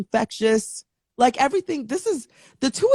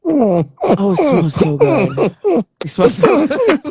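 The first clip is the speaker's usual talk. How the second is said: loud, swooning delight; 7 semitones higher, 8 dB louder, slower than usual. Real, not acted. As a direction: gush over the scent. Sniffing cologne, swooning.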